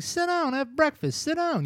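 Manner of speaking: high-pitched mock mom insisting; fussy mom. Retelling the mother calling her son to breakfast, a high mock mom voice. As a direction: squeak it out like a mother fussing over her son. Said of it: "his mother's like".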